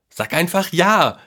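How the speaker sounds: put-on high, urging boyish cry; calling out. A narrator's reading. Boy urges grandpa to agree, high and eager. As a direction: urge it with pitch soaring. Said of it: "rief der Junge".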